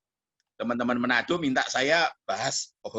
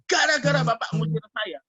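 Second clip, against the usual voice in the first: high-pitched wailing complaint; retold accusation; 10 semitones higher, 4 dB louder than usual. Evicted girl blaming the pastor, imitated complaint. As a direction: blame him in a lifted, upset voice. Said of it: "akhirnya dikatakan apa".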